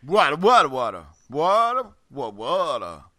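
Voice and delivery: a hint of a grumbling cartoon giant; Schnitzel voice. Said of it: "I mastered his voice, Schnitzel's".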